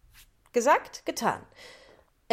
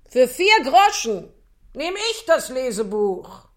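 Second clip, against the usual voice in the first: dealer calling out; 2 semitones higher, 7 dB louder than usual. Acted. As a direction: jump in and claim the book. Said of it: "rief ein Gebrauchtwarenhändler".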